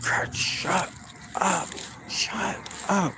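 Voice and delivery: soft, unvoiced plea to the dog; whispered shut up to the barking dog; hissed hush. Real, not acted.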